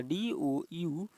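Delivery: slow, careful letter-by-letter spelling of डीओयूबीटी. Shown as a demonstration. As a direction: spell the word out letter by letter, slowly. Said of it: "doubt से लिखा हुआ है".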